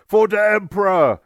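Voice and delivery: high, loud orc war cry; shouted battle cry. A narrator's reading. Orc mob following their boss into battle, shouting. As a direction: shout it out as you charge.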